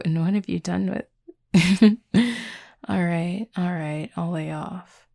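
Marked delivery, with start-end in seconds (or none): 1.5-5.1 word breaks into a laugh; a louder word, then laughter. Real, not acted. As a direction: crack up mid-sentence. Breaks into laughter mid-joke.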